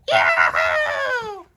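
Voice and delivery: high, loud "yeah" shout; excited whoop. Acted. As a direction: whoop it out, pumped up. Hyped before the ride.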